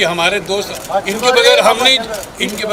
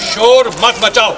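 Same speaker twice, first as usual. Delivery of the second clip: raised voice telling the crowd to hush; loud scolding; 4 dB louder than usual. Real, not acted. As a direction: call out firmly over the noise.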